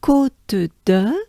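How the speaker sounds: "Côte de" slowly as koht-duh, rising; French name demo. Shown as a demonstration. Teacher presenting the whole name, rising French "Côte de". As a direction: separate each sound of "Côte de", lifting at the end. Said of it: "this name is".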